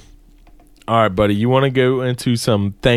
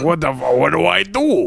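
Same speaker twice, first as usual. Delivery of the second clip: put-on Italian accent; 3 semitones higher than usual. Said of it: "me trying to do an Italian accent".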